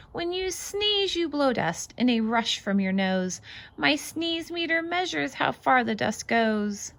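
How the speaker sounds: lively, bouncy read-aloud voice; singsong picture book reading; reading to children. Acted. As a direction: read it out like a storybook for little ones. Reads picture book aloud to kids.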